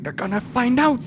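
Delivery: high, hushed pretend panic; hammed-up conspiratorial alarm; mock panic. Plays panic over a leaked secret.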